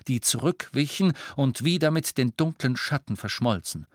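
ordinary delivery, nothing marked

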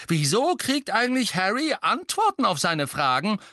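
hoch und laut, empört; wütend fordern. A narrator's reading. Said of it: "sagte Fred wütend". (Bruder beschwert sich wütend und laut)

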